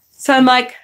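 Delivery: normal talking voice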